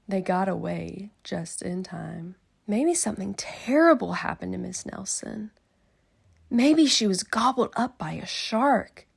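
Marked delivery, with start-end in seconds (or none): 2.7-9.1 loud, somewhat breathy fretting; worried kid. Acted. Kid imagining Miss Nelson's fate, loud worried guess. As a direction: fret like a scared child. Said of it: "said one of the kids".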